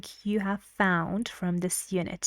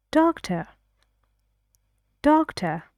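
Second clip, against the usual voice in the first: "Doctor" said higher and rising; rising tune; 6 semitones higher than usual. Shown as a demonstration. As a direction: suggest it, lift "Doctor".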